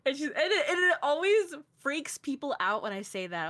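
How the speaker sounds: ordinary talk, nothing marked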